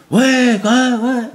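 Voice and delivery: mi-crié, plus haut et fort; imite un cri. Retold or imitated. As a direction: imite un type qui s'énerve. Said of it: "il a commencé à dire". (imitation d'un cri)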